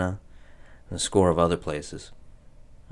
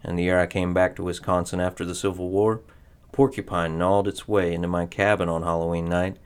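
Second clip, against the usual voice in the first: ordinary delivery, nothing marked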